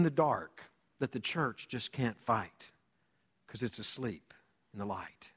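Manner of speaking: very soft and low; hushed solemn quote. Acted. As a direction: let the voice sink almost away. Preacher solemnly quotes song lyric.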